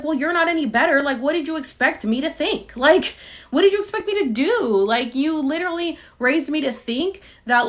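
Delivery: exasperated daughter snaps back at critical parents; retold angry retort. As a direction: fire it back at her parents. Retorting to her parents in anger.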